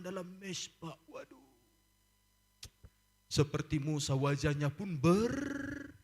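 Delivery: normal talking voice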